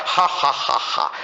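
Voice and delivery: slow, voiceless "ha ha ha ha"; acted defiant laugh. Preacher laughing off the devil's provocations, acted defiant laugh. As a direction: laugh it off in spaced, airy beats.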